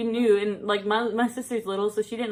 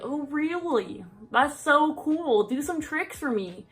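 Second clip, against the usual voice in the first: creepy neighbor voice; 5 semitones higher, slower than usual. Retold or imitated.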